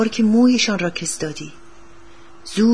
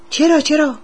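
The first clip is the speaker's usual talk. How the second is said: higher-pitched, quick eager answer; lightly acted eagerness; eager boy; 7 semitones higher, 4 dB louder than usual. A narrator's reading. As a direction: pipe up as the boy.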